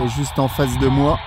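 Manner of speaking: usual speaking voice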